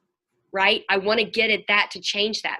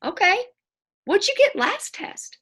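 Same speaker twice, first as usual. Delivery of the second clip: probing mom; 11 semitones higher than usual. Retold or imitated.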